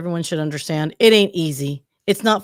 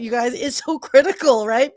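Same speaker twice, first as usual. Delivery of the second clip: laughing; 4 semitones higher, 3 dB louder than usual. Real, not acted. Laughing after near sneeze.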